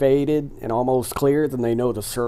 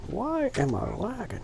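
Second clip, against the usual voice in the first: high-pitched exasperation; 12 semitones higher, 5 dB quieter than usual. Real, not acted.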